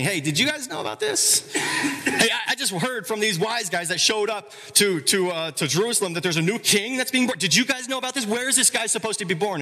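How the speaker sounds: high, laughing mock Herod; Herod asking staff. Herod quizzing his staff, high and laughing. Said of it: "he's asking".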